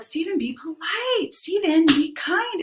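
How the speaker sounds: high, slow sing-song scolding; admonishing mom. Retold or imitated. Mom nudging her son to be polite, sing-song. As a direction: gently scold the kid, mom-style. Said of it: "I'm doing the".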